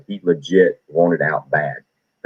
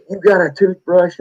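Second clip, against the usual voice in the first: much higher, louder drill sergeant bark; 11 semitones higher, 3 dB louder than usual. Retold or imitated. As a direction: demand it like a drill instructor. Drill sergeant questioning recruit.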